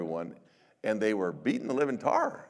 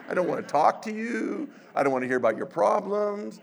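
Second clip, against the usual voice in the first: grumpy mock complaint; 10 semitones higher, 7 dB louder than usual. Voicing tired pastor's inner grumble.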